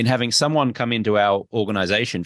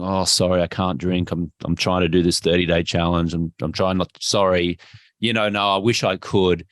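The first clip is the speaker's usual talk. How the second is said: lower, mopey, apologetic excuses; apologetic mumble; 5 semitones lower than usual. Shown as a demonstration. Guest apologises to friends for not drinking, mopey. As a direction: sound sorry for yourself. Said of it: "you're going".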